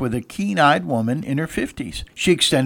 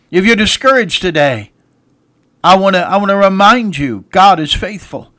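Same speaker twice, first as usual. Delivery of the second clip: high, loud, insistent pulpit appeal; preaching at full force; 4 semitones higher, 4 dB louder than usual. Acted. Preacher rallying weary listeners, loud and high. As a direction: lift the room with every "God is faithful".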